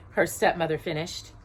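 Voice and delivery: ordinary delivery, nothing marked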